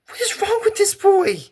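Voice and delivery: very high, incredulous outburst; exasperated disbelief. Real, not acted. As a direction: squeal it in indignant disbelief. Outraged at the boy's sledding stunt.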